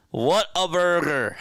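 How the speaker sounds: high, loud South Jersey Whataburger; hammed-up South Jersey twang; South Jersey accent. Shown as a demonstration. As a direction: belt What a burger in thick South Jersey. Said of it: "It's my South Jersey accent".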